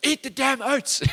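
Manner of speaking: high-pitched mock exasperated yell; exasperated dad outburst, hammed up; irritated. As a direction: act out a parent losing patience, high and comic. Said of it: "I just got so irritated".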